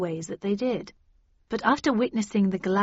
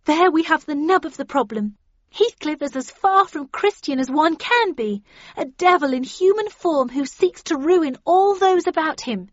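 put-on high, loud young woman's denunciation; raised, emphatic accusation; yelling over uproar; 8 semitones higher, 6 dB louder than usual. A narrator's reading. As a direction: make yourself heard above a quarrelling group. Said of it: "yelling to be heard above the uproar".

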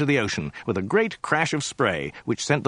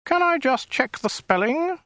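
high, thin alien voice; a small, prissy, weaselly official. Acted. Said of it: "in a small, weaselly voice".

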